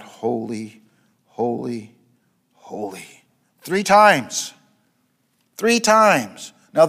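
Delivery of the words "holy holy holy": low, soft, fading holy; hushed reverent holy. Acted. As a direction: breathe the three holies low and softly. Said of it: "And they cried out".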